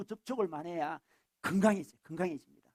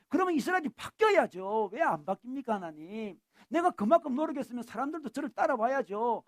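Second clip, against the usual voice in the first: raised-pitch, aggrieved pleading; venting to God; 3 semitones higher than usual. Acted. Elijah pours out his grievances before God, venting and protesting. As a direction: protest your innocence in an acted outburst of frustration. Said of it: "하나님 앞에 가가지고는 토해야 됩니다".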